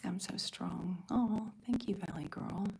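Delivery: normal talking voice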